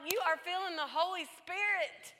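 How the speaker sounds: high, loud, fervent retold exclamation; joyful reassurance. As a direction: proclaim it to your crying daughter. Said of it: "I was like".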